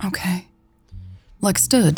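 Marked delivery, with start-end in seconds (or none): 0.0-0.5 much softer weak reply. Acted. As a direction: barely breathe it. Weak, unwell.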